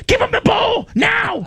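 very high, frantic yell; panicked shout. Retold or imitated. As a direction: scream the order in a panic. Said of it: "It's like".

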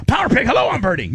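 high squealed safe word; mock panic. Lummy yelling the safe word under the bear, high mock panic. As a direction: squeal it over and over. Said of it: "No, no".

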